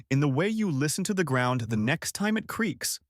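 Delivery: plain reading voice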